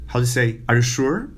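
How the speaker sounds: ordinary talk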